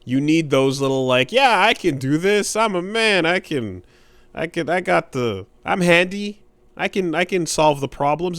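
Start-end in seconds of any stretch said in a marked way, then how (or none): 1.3-8.4 higher-pitched self pep talk; mock confidence. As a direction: hype yourself up, half-joking. Voicing his own handyman pep talk.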